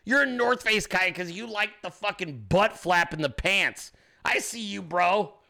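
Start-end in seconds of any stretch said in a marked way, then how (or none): none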